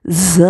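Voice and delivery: ز said with a sharp buzz. Shown as a demonstration. Teacher shows the whistling ز sound. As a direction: hit ز hard with the teeth together. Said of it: "تو اس کو پڑھیں گے".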